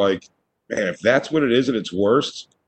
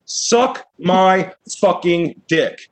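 slow drawn-out insult in a put-on voice; mock rude brush-off; 7 semitones higher, much slower than usual. Teen friend dismissing the warning, slow sneering put-on voice. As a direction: stretch every word like a dumb teenager.

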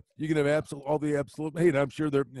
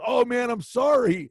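slow, higher and louder mock apology; a sheepish hint of sorry; apologetic player; 6 semitones higher, 4 dB louder, slower than usual. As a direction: play a hockey star caught spilling a fan's beer. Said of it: "he goes".

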